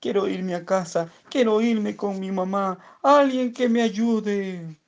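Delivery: sehr hohe, langsam werdende Bitte; flehend. Acted. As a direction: fleh verzweifelt nach Hause. Vögelchen fleht um Hilfe.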